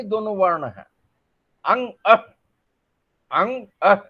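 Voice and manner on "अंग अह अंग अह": अंग with a ringing nasal ng, अह with a breathy h; nasal sounds demo. Shown as a demonstration. Teacher demonstrating अंग and अह to the camera. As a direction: show the nose moving on अंग. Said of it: "आप जब उच्चारण कीजिए, देखिए".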